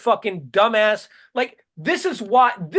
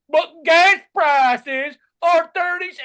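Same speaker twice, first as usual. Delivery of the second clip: mock dim voice; 6 semitones higher, much slower than usual. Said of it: "And they go".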